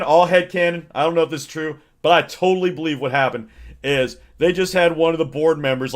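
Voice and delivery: normal talking voice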